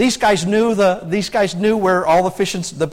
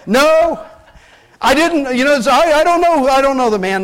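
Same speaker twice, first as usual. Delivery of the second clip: higher, louder, laughing protest; panicked denial; 6 semitones higher, 4 dB louder than usual. Acted. Peter denying Jesus to the crowd, panicked protest. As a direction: protest too much, half laughing. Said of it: "He says".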